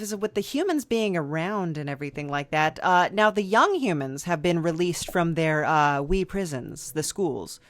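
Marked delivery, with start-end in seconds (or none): none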